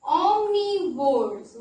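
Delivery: omnivores. stretched out slowly; slow key word. Shown as a demonstration. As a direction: draw out omnivores. for the children. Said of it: "Those animals are called".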